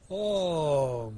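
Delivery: mid-yawn. Real, not acted. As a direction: say it through a yawn. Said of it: "I'm yawning".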